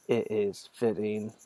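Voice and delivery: usual speaking voice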